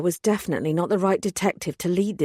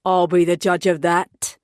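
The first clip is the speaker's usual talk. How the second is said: growled; 6 dB louder than usual. A narrator's reading. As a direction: growl it as a warning. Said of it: "Collier growled".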